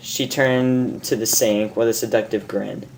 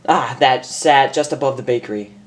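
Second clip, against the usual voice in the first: usual speaking voice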